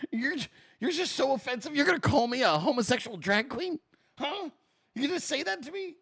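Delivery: high, quiet, incredulous mock indignation; mock outrage. To Twitter critics, quietly high mock-offended. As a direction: squeak out fake offense.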